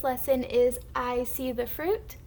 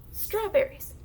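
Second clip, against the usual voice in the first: Strawberries quick and higher, falling; fast blend; 7 semitones higher than usual. Shown as a demonstration. Teacher shows moving the arm faster, fast blend. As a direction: say Strawberries briskly as one word. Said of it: "the faster you say it".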